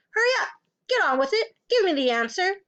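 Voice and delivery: high, pressing impatience; urgent, imperious prodding; impatient demand. A narrator's reading. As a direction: hound a pupil for the answer. Headmistress hounding a pupil.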